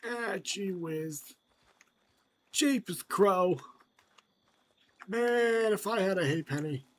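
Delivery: hammed-up old-timer voice. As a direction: grumble like a cheap old codger.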